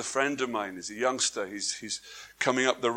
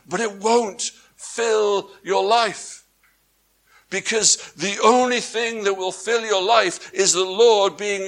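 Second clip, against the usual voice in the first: preaching climax; 5 semitones higher, 6 dB louder than usual. Acted. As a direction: build to a ringing proclamation.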